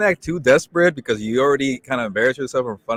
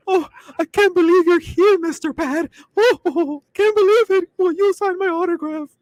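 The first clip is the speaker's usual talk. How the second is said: squeaky, very high starstruck falsetto; Mickey Mouse. Retold or imitated. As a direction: gush like a fan in a tiny cartoon squeak. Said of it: "I sound like Mickey Mouse".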